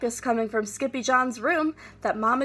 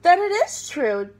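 decree; 7 semitones higher, 6 dB louder than usual. A narrator's reading. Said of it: "decreed Don Diego".

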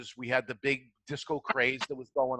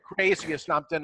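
higher, louder mock yell; imitated mother's scream; 6 semitones higher, 3 dB louder than usual. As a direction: call up to dad like an alarmed mother. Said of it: "she screamed up to my father".

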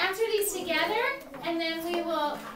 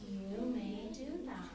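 soft, low, slow and measured; quiet Rosa; 6 semitones lower, 10 dB quieter, slower than usual. Acted. Teacher leads the class as Rosa, quietly. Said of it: "quietly say this together".

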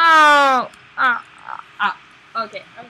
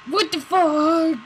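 startled; 5 semitones higher, 6 dB louder, much slower than usual. Real, not acted. Startled by monster noise.

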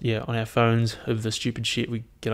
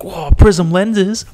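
high, loud, drawn-out mock amazement; voicing an excited dog; 8 semitones higher, 13 dB louder, much slower than usual. Puppy thrilled by his owner's sunglasses, hammed-up excitement. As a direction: exclaim it big and slow as the thrilled puppy. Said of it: "he was like".

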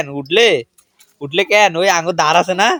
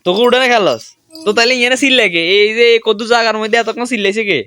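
angry scolding; 11 semitones higher than usual. Real, not acted. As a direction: tell them off for the racket. Scolding noisy kids at night.

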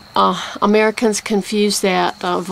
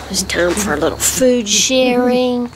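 gentle whisper. Real, not acted. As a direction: whisper it to the bird. Feeding the bird, repeated.

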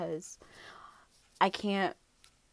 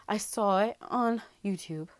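ordinary talk

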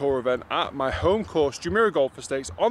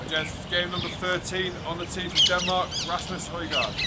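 starter announcement; 6 dB quieter than usual. Real, not acted. Real starter announcement on tee.

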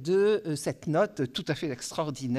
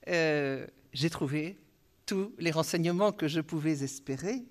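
ordinary talk

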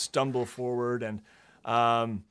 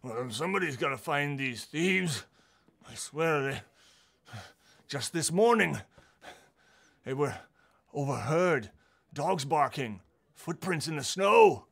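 agitated guard; 3 semitones higher, 5 dB louder than usual. Acted. Trovis rants about thieves.

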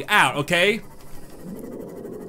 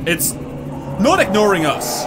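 slow, drawn-out alarm, pitched up; alarmed realisation; 4 semitones higher, slower than usual. Real, not acted. Player realising the eel is coming, alarmed. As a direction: stretch it out in rising panic.

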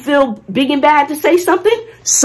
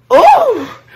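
high excited oh; 7 dB louder than usual. Real, not acted. Excited about reaching day four.

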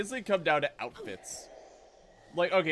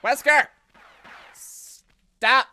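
loud frustrated yell at the villain; shout; 8 semitones higher, 10 dB louder, much slower than usual. Real, not acted. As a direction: yell his name loud and high. Yelling at game villain.

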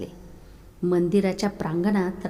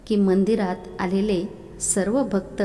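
ordinary delivery

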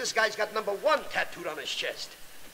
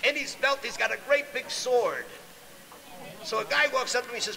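normal talking voice

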